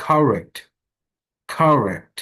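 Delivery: slow "correct" with the r sounded; model word. Shown as a demonstration. Teacher modelling "correct" for the class. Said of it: "রার পরে একটা এ sound".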